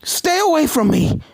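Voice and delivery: high, loud panicked cry; frightened shout. Acted. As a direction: scream it at them to keep them back. Starlight cornered, shouting in fear.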